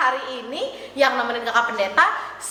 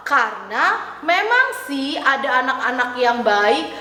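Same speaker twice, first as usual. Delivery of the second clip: ordinary talk, nothing marked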